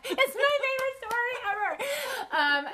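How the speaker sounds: high, loud giggling through the words; laughing. Real, not acted. To her co-host about her favorite story, laughing.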